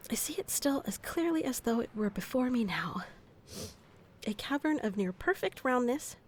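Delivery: quiet story narration; reading aloud. Acted. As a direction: read it on, trying to stay calm. Reads old book aloud again.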